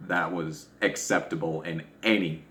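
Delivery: ordinary talk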